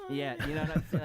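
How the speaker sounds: Matt Atkinson impression. Shown as a demonstration. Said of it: "you gotta raise your shoulders up to your ears".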